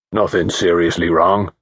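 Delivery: low, hoarse country mechanic; a rough-voiced village mechanic, unbothered. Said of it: "in a low, hoarse country voice".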